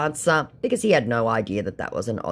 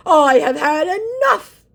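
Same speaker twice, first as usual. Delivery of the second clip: high, slow indignant put-on voice; mocking the scammer's outrage; 9 dB louder, slower than usual. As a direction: declare it like a huffy scammer. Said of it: "he declares".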